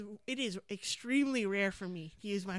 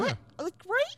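indignant plea; 11 semitones higher, 3 dB louder than usual. Retold or imitated. Continuing indignant self-quote.

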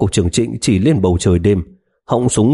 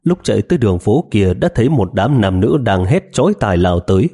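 ordinary talk

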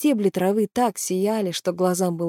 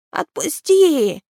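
creature cry; 10 semitones higher, 5 dB louder, much slower than usual. Acted. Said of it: "закричал Граги".

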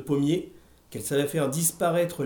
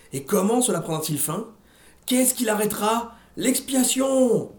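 montée vers un cri aigu, L'expiation! très lent; hurlé; 8 semitones higher, 3 dB louder than usual. A narrator's reading. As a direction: lire en crescendo jusqu'au hurlement. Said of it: "Hurla le bon vieux Miron Lafleur". (prêche qui monte jusqu'au cri)

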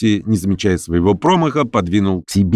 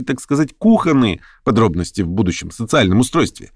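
higher-pitched, indignant argument; mildly heated; 8 semitones higher than usual. A narrator's reading. Lame man defends talk of social order, simmering. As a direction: press the case with a touch of heat. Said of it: "вскипал всё более и более Хромой".

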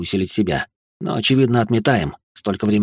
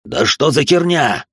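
higher, baffled angry bark; barked outburst; 6 semitones higher, 3 dB louder than usual. A narrator's reading. Demonologist hearing an unknown voice behind him, barking. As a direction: bark the questions out in alarm. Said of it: "гаркнул демонолог".

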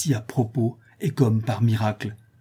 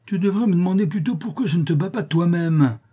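high, indignant retort; scolding in a raised pitch; angry confrontation; 8 semitones higher than usual. A narrator's reading. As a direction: threaten him indignantly. Elder angrily confronts animal trainer.

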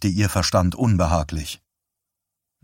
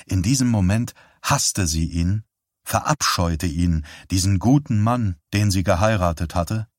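normal talking voice

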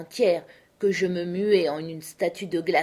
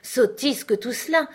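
brisk dismissal; 5 semitones higher, 3 dB louder than usual. A narrator's reading. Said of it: "je déclarai avec une énergie familière".

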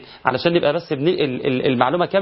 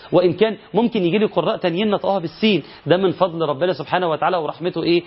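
ordinary talk

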